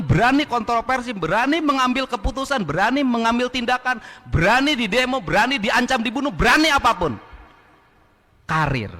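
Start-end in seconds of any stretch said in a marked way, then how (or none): 0.0-7.2 rising rally-speech cadence. Real, not acted. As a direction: fire up the crowd, building.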